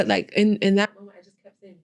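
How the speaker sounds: ordinary talk, nothing marked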